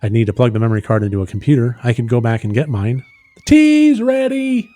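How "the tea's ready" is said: high, loud, drawn-out call. Acted. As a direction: holler it high and stretch it out. Calling out that tea is ready.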